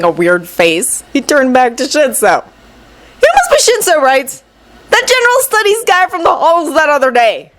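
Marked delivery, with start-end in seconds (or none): 3.2-7.5 put-on high, excited young guy; realizing with glee. Kaminari's voice in story.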